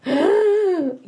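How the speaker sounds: sharp high dramatic gasp; mock shocked gasp. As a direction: gasp in playful outrage. Mock shock at a rival pick.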